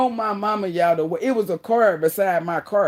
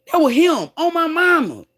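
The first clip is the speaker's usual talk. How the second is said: excited insistence; 9 semitones higher, 4 dB louder than usual. Real, not acted. Excited swearing to the story.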